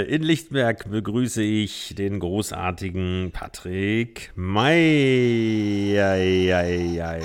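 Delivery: booming ring announcer, drawn out; lucha libre announcer. Pretend. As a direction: announce the fighter, big and slow. Guest introduced like a ring fight.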